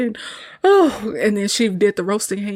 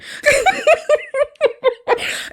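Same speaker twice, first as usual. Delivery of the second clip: very high, louder burst of laughter; real laugh; 4 dB louder than usual. Real, not acted. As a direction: crack up for real. After saying it's funny.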